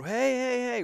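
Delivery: high, loud shout to flag someone down; calling out. Retold or imitated. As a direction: yell after a departing car. Employee calling out from his car.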